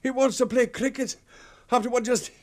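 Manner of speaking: high, loud disbelief; incredulous outburst. Acted. Outraged reaction to cricket request.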